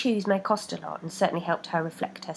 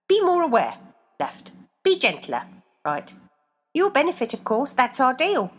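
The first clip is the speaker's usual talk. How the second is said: higher, louder demand; foot voices; 6 semitones higher, 4 dB louder than usual. A narrator's reading. Feet demanding of Rebecca, higher voices. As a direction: lay down terms.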